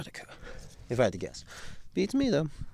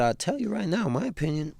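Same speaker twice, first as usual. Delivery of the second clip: much higher put-on voice; high, serious official; 8 semitones higher than usual. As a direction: imitate a dean's high-pitched voice. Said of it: "his high and serious Soto voice".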